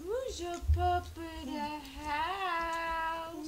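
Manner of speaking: very slow, high dog talk. Real, not acted. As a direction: coo slowly at the pet. Baby talk to the puppy.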